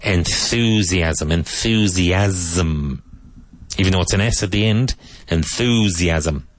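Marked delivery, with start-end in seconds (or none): 0.0-3.0 Enthusiasm drawn out slowly, clear TH, both s sounds buzzing as z; deliberate teacher model of Enthusiasm; slow model pronunciation. Shown as a demonstration. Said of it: "be careful of your T H here". 5.3-6.5 Enthusiasm drawn out slowly, clear TH, both s sounds buzzing as z; deliberate teacher model of Enthusiasm; slow model pronunciation. Shown as a demonstration. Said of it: "Both S's in that word sound like a Z".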